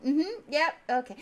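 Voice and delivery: very high and loud, pleased fussing; smug tabaxi host. Acted. Tabaxi showing the party their reserved table, high and loud. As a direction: present the table proudly. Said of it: "And he's like".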